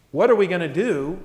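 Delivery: full-voiced question with weight on "we"; fretting question. People faced with a problem, fretting question. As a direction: ask it openly, as a shared problem. Worried question set against trusting one.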